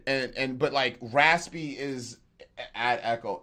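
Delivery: ordinary talk, nothing marked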